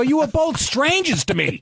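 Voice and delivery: high-pitched mock protest; retold indignant excuse; exasperated self-defense. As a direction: argue back at your wife, voice shooting up. Acted defense to his resentful wife.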